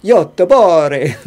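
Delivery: loud, drawn-out mock Italian accent; playful, exaggerated Italian accent. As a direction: belt it slowly like a hammed-up Italian. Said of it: "Like an Italian?".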